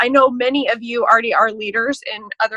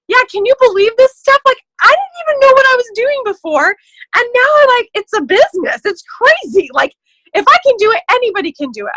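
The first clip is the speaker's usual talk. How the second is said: much higher, louder bubbly gushing; excited gushing; 12 semitones higher, 6 dB louder than usual. Retold or imitated. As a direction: gush like a thrilled new convert. Acted upbeat recruiting message.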